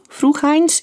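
ordinary delivery

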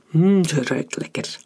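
savouring hum, then leering remark; creepy appreciative murmur; sniffing. A narrator's reading. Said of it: "snoof Emmeram".

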